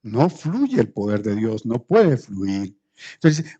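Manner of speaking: normal talking voice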